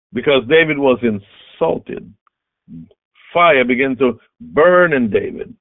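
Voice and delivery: ordinary talk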